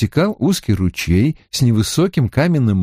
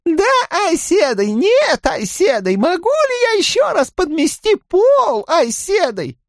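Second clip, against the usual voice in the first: high squeaky mocking novice voice. A narrator's reading. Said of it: "она произнесла писклявым голосом".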